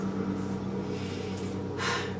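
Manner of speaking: long unvoiced weary exhale; heavy sigh. Real, not acted. Recalling the TSA agent's threat, a heavy sigh. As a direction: let out a deep, tired breath. Sigh after recounting humiliation.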